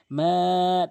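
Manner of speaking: "মা" held long; lengthened MA. Shown as a demonstration. Teacher shows one-alif length, "মা" drawn out. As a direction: stretch the vowel of "মা". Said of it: "টেনে পড়তে হবে এক আলিফ".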